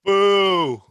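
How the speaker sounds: mock boo. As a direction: boo him as a joke, then drop it. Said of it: "No, I'm joking".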